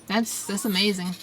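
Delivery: ordinary delivery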